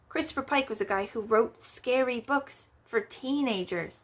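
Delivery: ordinary delivery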